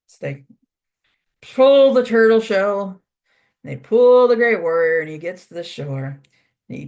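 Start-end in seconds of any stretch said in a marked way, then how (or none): 1.4-5.0 loud, higher, stretched-out storytelling. A narrator's reading. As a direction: lean long and hard on each pull. Narrating beavers' heavy pulling.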